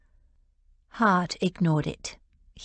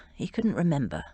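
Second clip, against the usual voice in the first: ordinary delivery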